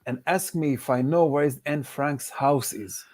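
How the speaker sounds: ordinary talk, nothing marked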